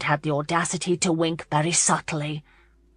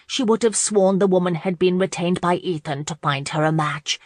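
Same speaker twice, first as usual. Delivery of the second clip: plain reading voice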